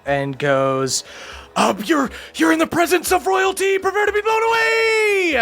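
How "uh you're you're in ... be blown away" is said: high, shouted royal announcement; booming royal proclamation. Acted. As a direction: announce yourself like a queen, shouting. Air queen's grand entrance.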